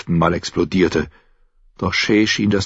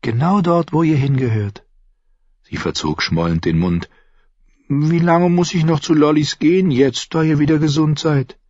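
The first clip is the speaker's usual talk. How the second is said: junge Frau, höher gesprochen, neugierig; Shay; 9 semitones higher than usual. Acted. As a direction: fragt ihn leise drängend aus. Shay neckt und fragt. (Shay, junge Frau, höhere Stimme)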